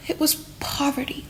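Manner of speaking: sigh, then whisper it; sighing whisper of a grim truth. A narrator's reading. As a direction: breathe it out like a sad secret. Sad revelation of the cause.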